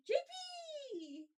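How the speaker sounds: loud, high, drawn-out cheer. Real, not acted. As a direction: hype up the pet, loud and high. Hypes the dog loudly.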